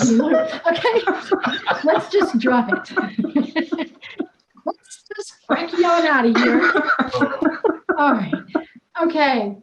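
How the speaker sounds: words breaking up with real laughter; giggling through the words after a silly joke; laughing. Real, not acted. Cracking up at own accent.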